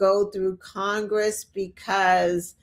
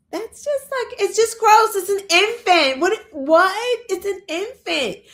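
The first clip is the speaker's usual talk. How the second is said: much higher, louder disgusted outburst; 8 semitones higher, 3 dB louder than usual. Real, not acted. Vlogger reacts to comments about an infant daughter, disgusted outburst. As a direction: recoil in disgust.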